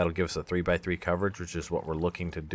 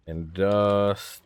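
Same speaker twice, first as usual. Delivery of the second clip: very slow, elongated word; drawn-out syllables; much slower than usual. Real, not acted. Player typing a search term to himself, drawn out.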